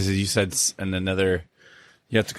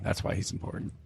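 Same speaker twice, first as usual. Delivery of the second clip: whispered; 11 dB quieter than usual. Real, not acted. Deadpan whispered aside.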